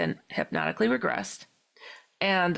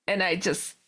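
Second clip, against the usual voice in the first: ordinary talk, nothing marked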